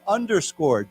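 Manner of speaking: underscored spoken slowly for learners to repeat; teacher demonstrating underscored; underscored said clearly. Shown as a demonstration. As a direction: model underscored for the class. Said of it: "why don't we say together".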